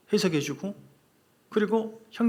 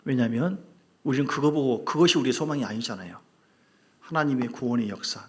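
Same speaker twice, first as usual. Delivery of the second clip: ordinary talk, nothing marked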